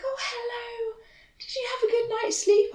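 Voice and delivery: very high, sing-song greeting; chirpy receptionist. Retold or imitated. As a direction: put on a squeaky front-desk greeting. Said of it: "receptionist. She was like".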